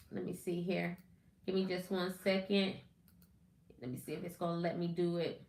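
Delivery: quiet aside while fixing the stream; muttering to herself. Real, not acted. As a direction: mumble it under the breath, distracted.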